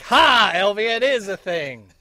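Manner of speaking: loud, high gloating "ha"; mock triumph. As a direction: crow it in playful vindication. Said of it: "And then you were like".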